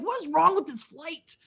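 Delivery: high-pitched disbelieving protest; mock exasperation. Fan among clueless passengers, high exasperated disbelief. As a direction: exclaim it high, in mock outrage.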